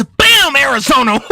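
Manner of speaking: ordinary talk, nothing marked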